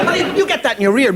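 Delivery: ordinary delivery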